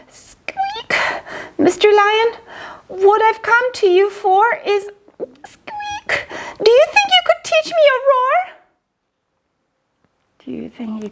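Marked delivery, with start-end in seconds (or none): 0.1-0.9 high whispered mouse squeak; mouse's nervous squeak; tiny squeak. Acted. 1.6-4.9 much higher, timid mouse voice; high squeaky mouse asking the lion. Acted. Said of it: "Mouse peeped". 5.5-6.3 high whispered mouse squeak; tiny squeak. Acted. 6.6-8.4 very high, fast mouse plea; high squeaky mouse begging for a roar lesson. Acted. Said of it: "Mouse peeped".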